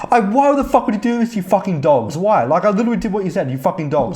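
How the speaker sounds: high, loud mock protest; imitated outraged yelling. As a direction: yell it like a betrayed, dying player. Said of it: "he's yelling".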